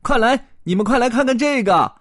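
high, loud call. Acted. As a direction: shout for the others to come see. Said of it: "艾玛喊".

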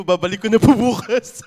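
loud, high, laughing promise. Shown as a demonstration. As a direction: confess it laughing. Joking apology for stolen stapler.

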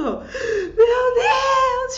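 retold sobbing shout, high and loud; crying out. Woman on the phone to the father, crying out. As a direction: cry out in tears on the phone. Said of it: "Chorando, chorando, chorando".

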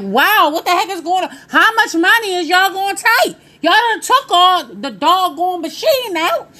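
high, incredulous mock outburst at the robbers; comic high-pitched indignation; exasperated disbelief. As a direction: demand it like you're fed up and amazed.

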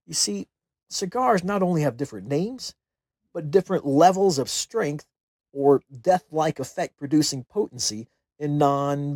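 ordinary delivery

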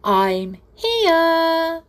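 high, loud, drawn-out announcement; triumphant cry. A narrator's reading. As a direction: reveal yourself with a big call. Hidden doll announces herself triumphantly.

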